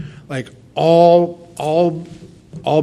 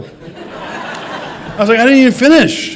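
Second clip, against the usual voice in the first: laughing, high and loud; laughing as son cuts him off; 9 semitones higher, 3 dB louder, slower than usual. Real, not acted. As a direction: burst out laughing mid-line. Laughing at son's quick no.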